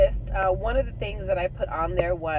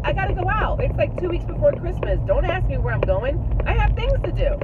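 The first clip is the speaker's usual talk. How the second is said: higher, louder brush-off; evasive brush-off; 9 semitones higher, 4 dB louder than usual. Retold or imitated. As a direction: wave them off breezily. Brushing off her kids.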